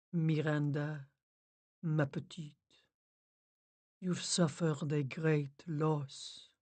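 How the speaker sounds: soft, slowing French medium voice; soft sympathy. A narrator's reading. Medium consoling grieving Miranda, low soft sympathy. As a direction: console gently, drawing out the words. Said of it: "her voice low and soft and full of sympathy".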